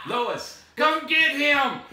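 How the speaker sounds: loud, high, drawn-out holler; slow, stretched shout across the place; calling out. Pretend. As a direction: yell for the wife to come over. Calling the wife over.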